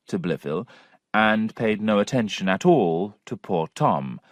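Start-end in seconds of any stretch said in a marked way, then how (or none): none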